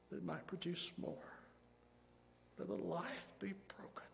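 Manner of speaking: very soft, half-whispered prayer with long pauses; hushed pleading prayer. Real, not acted. Pastor begging God to work here and now, hushed pleading prayer. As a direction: plead almost under the breath, phrase by phrase.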